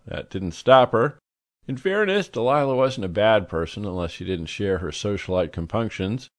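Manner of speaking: ordinary delivery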